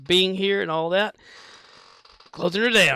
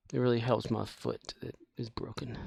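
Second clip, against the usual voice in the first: normal talking voice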